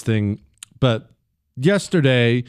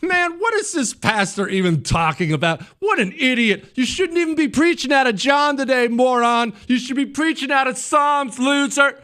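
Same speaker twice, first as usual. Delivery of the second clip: mocking, much higher sneer at the pastor; arrogant new convert; 13 semitones higher than usual. New convert sneering at the pastor, much higher mocking voice. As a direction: play a smug know-it-all heckling the sermon. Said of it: "sit down in the pew and say".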